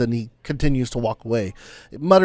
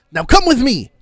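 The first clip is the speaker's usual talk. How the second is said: urging; 9 semitones higher, 5 dB louder than usual. Acted. NPC urging party.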